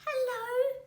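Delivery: tiny high little voice; baby possum greets from the pouch, tiny voice; small voice. A narrator's reading. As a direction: peep hello like a baby in a pouch. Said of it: "said a small voice".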